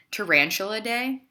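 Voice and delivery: lively read-aloud to a class; story narration. A narrator's reading. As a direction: read it like a picture book for kids. Teacher reads picture book aloud.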